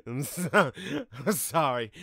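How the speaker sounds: laughing through a loud apology. Real, not acted. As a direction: giggle while saying sorry.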